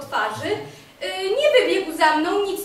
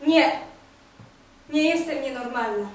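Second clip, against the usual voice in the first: ordinary talk, nothing marked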